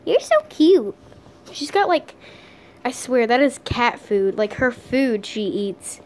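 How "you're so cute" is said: cooing at dog. Real, not acted. Owner gushing at her puppy, high loud coo. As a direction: squeal over how cute the puppy is.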